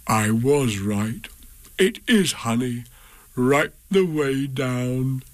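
Pooh lingering over every lick. Acted. Pooh tasting honey, slow.

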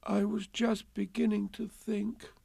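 high, tearful little voice; slightly sniffing. A narrator's reading. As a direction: say it as if holding back sniffles. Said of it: "sniffing slightly".

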